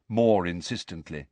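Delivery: usual speaking voice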